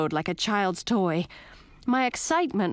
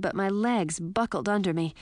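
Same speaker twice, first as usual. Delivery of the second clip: plain reading voice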